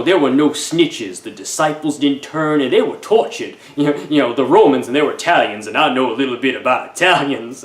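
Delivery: lightly voiced Italian mobster accent; a hint of a Sopranos wiseguy accent. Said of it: "bad Italian accent".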